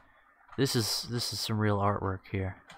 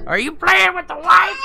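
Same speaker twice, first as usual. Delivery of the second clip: high, loud scolding pet voice; talking to a pet; 15 dB louder than usual. Real, not acted. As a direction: catch the pet mid-mischief. Catching pet with wipes.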